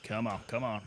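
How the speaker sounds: winded from the climb; huffing and puffing; out of breath. Real, not acted. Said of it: "Oh, I'm huffing and puffing".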